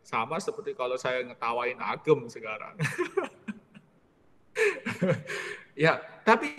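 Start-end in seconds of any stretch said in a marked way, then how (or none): 2.8-3.5 short high laugh. Real, not acted. Preacher laughs at Agem, short and high.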